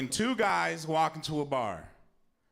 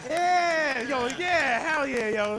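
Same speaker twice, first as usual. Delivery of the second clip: loud, high hype-man yells; hype cheer; 8 semitones higher, 4 dB louder, slower than usual. Real, not acted. Host cheering for the dead crowd, loud and high. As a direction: pump up a silent crowd.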